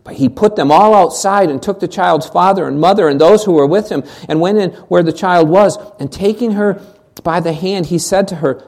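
usual speaking voice

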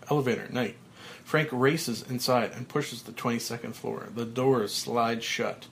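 ordinary delivery, nothing marked

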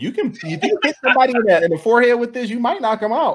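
high, fast and laughing; getting hyped about the mace; excited. Real, not acted. Excited joke about the ball.

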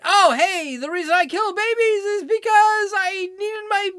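high, loud sneering parody of a boastful doctor; hammed-up mocking quote; mock provider voice. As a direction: mimic her like a cartoon villain bragging. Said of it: "And you've admitted it".

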